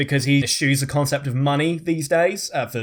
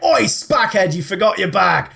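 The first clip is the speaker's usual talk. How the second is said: higher, louder mock yell; calling out; 5 semitones higher, 4 dB louder than usual. Rich dad calls to son about his bag, mock yelling.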